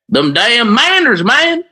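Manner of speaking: Manders drawn out in a high Southern twang. Shown as a demonstration. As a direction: say Manders with a thick country twang. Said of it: "like, a southern twang".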